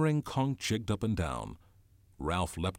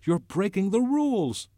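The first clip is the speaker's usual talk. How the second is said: shouted; 13 semitones higher, 8 dB louder than usual. A narrator's reading. Ralph accuses Jack, shouted. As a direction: shout the accusation at full pitch. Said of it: "shouted Ralph".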